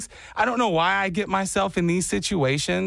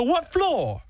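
higher, hammed-up Jersey attempt; bad Jersey accent; 10 semitones higher than usual. Jersey stranger asks the floor, bad Jersey accent. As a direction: badly fake a Jersey accent. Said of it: "I'm from Jersey".

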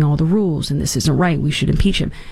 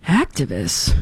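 Activists? said low and flat, falling as if reading it; deadpan repeat; 5 semitones lower, slower than usual. Retold or imitated. Host repeating the news label back, deadpan. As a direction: read the word back dryly. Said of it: "I was like".

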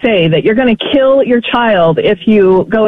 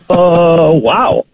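understated reaction; 6 semitones lower than usual. Retold or imitated. Acting her own dry reaction.

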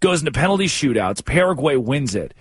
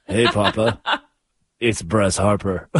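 sexy voice; 5 semitones lower than usual. Retold or imitated. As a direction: lightly purr it, laughing. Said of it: "all cute and sexy like".

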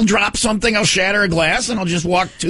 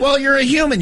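usual speaking voice

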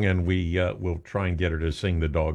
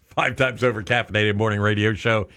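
louder, higher announcer patter with laughter; hammed-up radio announcer; 6 semitones higher, 5 dB louder than usual. Host bigging up his co-host's song, hammed-up announcer. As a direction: sell the intro like a morning DJ.

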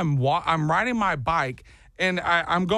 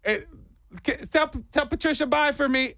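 mock sweet farewell; 12 semitones higher than usual. Sarcastic farewell through door.

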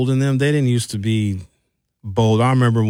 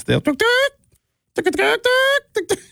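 high, quick mock chicken "tuk, tuk"; chicken clucking. Host mimics his neighbors' chickens with high clucks. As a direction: cluck like a backyard chicken, pitched way up.

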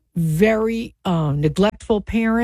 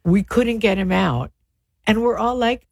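ordinary talk, nothing marked

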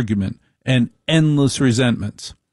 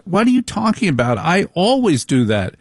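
indignant wife; 6 semitones higher than usual. Retold or imitated. Wife's indignant reply.

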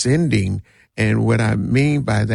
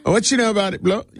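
put-on Jamaican accent; 10 semitones higher, much faster than usual. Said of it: "when I try to do Jamaican accents".

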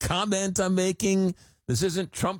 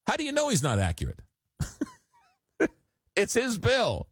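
incredulous; 9 semitones higher than usual. Real, not acted. Host rebuts texter, laughing.

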